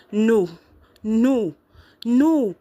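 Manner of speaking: loud, high cries of denial; childlike tantrum of refusal; defiant refusal. A narrator's reading. Girl rejects truth, stomps foot.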